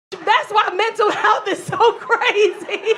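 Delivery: words broken by laughter; laughing through it. Real, not acted. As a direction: crack up mid-sentence.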